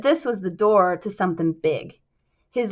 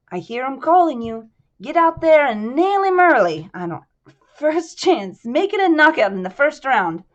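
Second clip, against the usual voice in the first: put-on a higher, sly Little Italy accent; lightly voiced Italian-American mobster; 5 semitones higher, 4 dB louder than usual. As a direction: lean in like a gangster sharing a secret. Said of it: "I'm still doing his accent".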